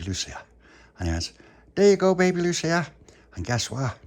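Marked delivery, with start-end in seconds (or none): 1.7-2.9 high, loud, chirpy offer; pitched-up talk to a baby; Tony addressing baby. Acted. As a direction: sing out to a little one. Tony hands baby a treat.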